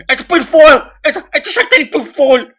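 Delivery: high loud shriek, laughing through it; mock hysterical shrieking. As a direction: scream it like a hysterical mob. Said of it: "they were going on about".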